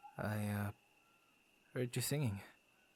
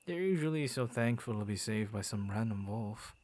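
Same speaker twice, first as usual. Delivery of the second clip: ordinary talk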